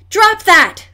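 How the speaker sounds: high, loud command to stop; crying out. A narrator's reading. Nancy chasing the thief down the corridor, shouting. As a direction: yell at him to drop it. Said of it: "Nancy cried out".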